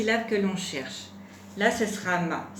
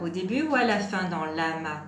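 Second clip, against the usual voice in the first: normal talking voice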